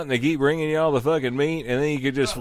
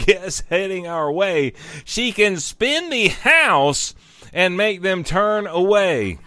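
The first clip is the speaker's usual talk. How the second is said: ordinary talk, nothing marked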